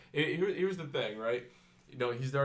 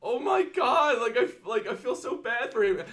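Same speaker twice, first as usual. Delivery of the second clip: high, laughing imitation of a sympathetic friend; mocking friend; 11 semitones higher, 7 dB louder than usual. Mocking the friend's pity for the beaten champion, high and laughing. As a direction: play up her pity while cracking up. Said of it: "she's like".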